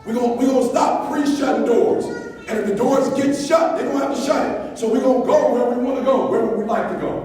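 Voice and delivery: high, loud, driving sermon voice; fired-up preaching. Real, not acted. Mentor rallies his students to stop doubting, loud preaching. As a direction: pound it home like a preacher at full force.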